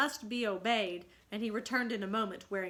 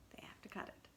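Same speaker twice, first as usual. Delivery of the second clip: whisper; 16 dB quieter, faster than usual. Real, not acted. Teacher answers own question softly.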